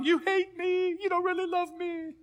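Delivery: imitating a wife crying to her husband; mock sobbing wife. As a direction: ham up a tearful new bride.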